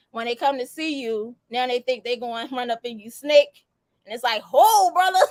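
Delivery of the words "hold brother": loud, high mock objection with laughter; acted startled halt. Host halting a lover in a scenario, mock alarm. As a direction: cut in loudly, half laughing.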